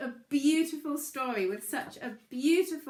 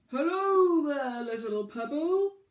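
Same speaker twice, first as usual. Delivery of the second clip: booming whale voice; 3 semitones higher, 8 dB louder, slower than usual. A narrator's reading. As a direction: boom it up from the depths, slowly. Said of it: "a booming voice called out".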